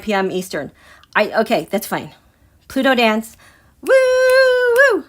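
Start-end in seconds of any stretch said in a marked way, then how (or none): 3.8-5.0 high, loud drawn-out "woo"; excited whoop. Real, not acted. Astrologer celebrating her Pluto dance, excited whooping.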